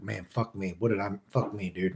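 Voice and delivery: quiet frustrated mumble; muttering to himself. Retold or imitated. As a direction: grumble it under your breath. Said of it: "I'm like".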